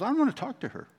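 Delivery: high, whiny mock protest of a daughter. Pastor imitating his upset daughter refusing to call, high and whiny.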